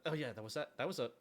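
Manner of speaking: ordinary delivery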